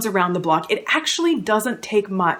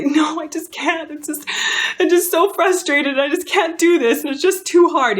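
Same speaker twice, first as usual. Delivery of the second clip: high, louder whiny protest, retold; play-acting her own exasperated younger self, voice pitched up; mock frustrated whine; 9 semitones higher, 4 dB louder than usual. Retelling to viewers how she protested to her husband, high mock whine. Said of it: "And I'd say".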